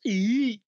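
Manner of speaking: high, loud cartoon frog "eek"; Kermit the Frog squeal. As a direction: squeal like a startled puppet. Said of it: "then Kermit the Frog goes".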